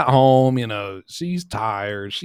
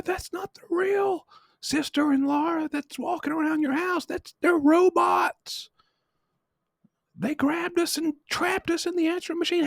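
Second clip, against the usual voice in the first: high mock panic voice. Pranksters on the machine begging Paul for help in squeaky panic. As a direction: squeal it like trapped kids begging.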